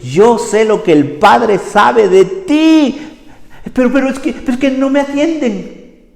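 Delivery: high, loud, plaintive lament; Holy Spirit lamenting that nobody listens; sad Holy Spirit. Retold or imitated. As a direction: sound hurt and ignored, pleading. Holy Spirit still speaking.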